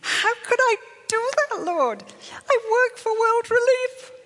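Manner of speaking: high, loud, distraught pleading to the Lord; anguished remorse. Retold or imitated. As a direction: cry out in guilty despair. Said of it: "the guilt and the shame".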